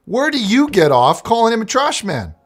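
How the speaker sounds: indignant host. Acted. Host defends trash man.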